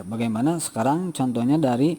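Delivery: normal talking voice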